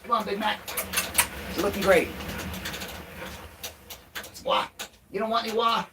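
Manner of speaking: high, sing-song pet voice; doggy talk. Real, not acted. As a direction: coo and cheer the dog along. Cheers dog Big Mac on.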